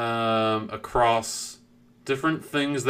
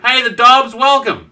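loud, high, excited welcome; shouted greeting; 12 semitones higher, 11 dB louder, slower than usual. Real, not acted. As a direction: hail a newcomer at full volume. Greeting a newcomer in chat.

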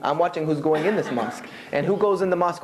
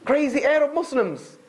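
laughing; 9 semitones higher than usual. Real, not acted. Laughing at his own story.

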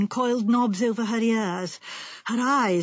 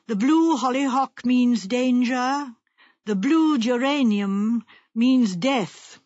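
slow, intoned fortune teller's warning; ominous prophecy; 2 semitones higher, 3 dB louder, slower than usual. Acted. Fortune teller's letter warning wife of blue flowers, ominous. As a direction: draw out each omen of doom.

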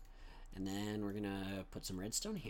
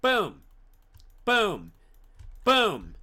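shouted booms; 8 semitones higher, 12 dB louder, much slower than usual. Pretend. Shouted sound effects.

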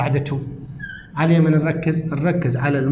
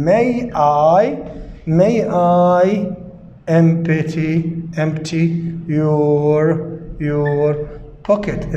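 each word said slowly and repeated; slow dictation; much slower than usual. Shown as a demonstration. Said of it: "teacher dictates word by word". (إملاء بطيء كلمة كلمة)

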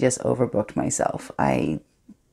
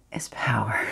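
whisper; 3 semitones lower, 3 dB quieter than usual. Acted. Secret thrill in monologue.

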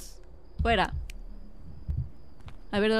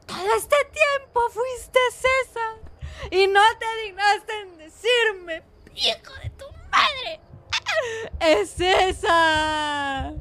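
high, drawn-out hammed-up sobbing; overdone crying lament; mock wailing; 7 semitones higher, much slower than usual. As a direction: wail slowly like a betrayed telenovela lover. Mock weeping over César's betrayal.

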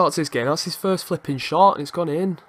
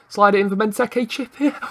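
mounting excitement; 6 semitones higher, 3 dB louder than usual. Real, not acted. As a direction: voice climbing high with the chance. Attack builds to a wonder goal.

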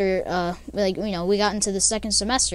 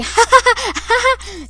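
high breathy giggle, much higher; high weird cackle; 11 semitones higher, 5 dB louder than usual. Retold or imitated. Narrator mimicking a classmate's strange laugh again: high giggle.

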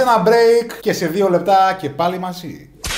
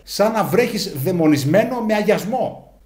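usual speaking voice

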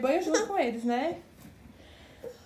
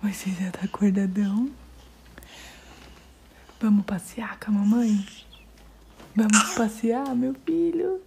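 whisper; 2 semitones lower than usual. Real, not acted. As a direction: whisper an invitation to the little one. Whispering to the awake baby. (sussurro carinhoso para o bebê)

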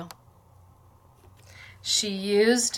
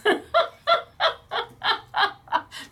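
long, high-pitched laughter; real, sustained giggling; amused laugh; 12 semitones higher than usual. Real, not acted. Laughing about tiny staff.

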